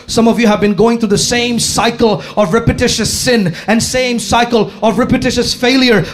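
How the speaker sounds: high-pitched, driving sermon; impassioned preaching. Real, not acted. Preacher pleading with congregation about faith, impassioned preaching.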